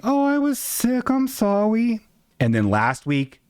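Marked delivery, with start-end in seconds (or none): 0.0-2.0 high, slow, apologetic mock; mock therapist. Therapist's email excuse, high mocking sorry voice. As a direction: pitch it way up, sheepish. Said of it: "sent me an email saying".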